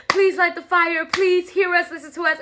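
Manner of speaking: pleading worshippers. Retold or imitated. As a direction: imitate a crowd begging their god. Worshippers begging Baal, retold.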